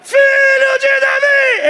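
very high, loud, drawn-out shout. Acted. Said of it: "Ele levantou a voz".

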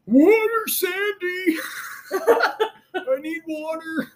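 very high, squeaky cartoon plea for water; hammed-up parched falsetto wail; SpongeBob. Mocking his runner's call for water, SpongeBob impression. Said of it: "For my SpongeBob fans".

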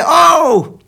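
sudden high loud cry of Oh; shouted Oh. Retold or imitated. The Bible crying out to us, a loud high shout. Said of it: "ci grida, ci urla".

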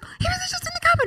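put-on fast, very high kid voice; quick squeaky little-boy reply; excited child. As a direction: imitate a boy blurting out his find. Excited son answering mum.

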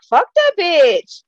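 mock outraged crowd. Everybody reacting angrily.

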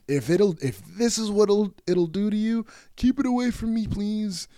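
high, squeaky-pitched comic plea; hammed-up plea to be spared; mock pleading. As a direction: beg off with your voice way up high.

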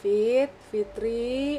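very slow, drawn-out haunting call; slow eerie calling. Retold or imitated. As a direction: drag the name out like a ghost. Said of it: "Gitu tuh nadanya?".

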